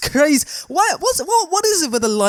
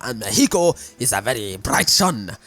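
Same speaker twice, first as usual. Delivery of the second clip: a lower, hammed-up Mexican accent; mock Mexican accent; 7 semitones lower than usual. Vlogger jokes about sunny Mexico in a mock Mexican accent. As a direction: put on a jokey Mexican accent for the sunny setting. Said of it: "I don't know what kind of accent that was".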